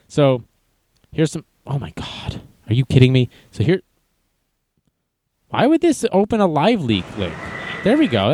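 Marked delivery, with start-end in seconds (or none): none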